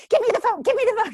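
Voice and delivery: high, loud insistent demand; snatch the phone, high and loud; mock mother-in-law demanding the phone. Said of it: "And I heard her".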